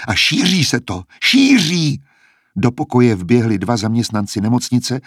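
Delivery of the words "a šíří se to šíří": panicked cry climbing to a high shriek. A narrator's reading. Klemencie shows her scaly skin to him, shrieking in panic. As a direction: build to a horrified, high-pitched shriek. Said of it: "zaječela".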